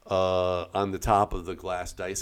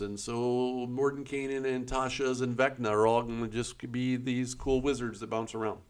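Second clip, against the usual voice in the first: normal talking voice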